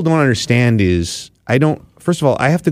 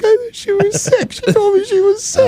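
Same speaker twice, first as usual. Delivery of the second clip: mock squealed protest, far above usual voice; high-pitched protest. Jilted guy protesting to his buddy, mock high-pitched whine. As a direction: whine it in a comic falsetto. Retelling his own upset reply to friend.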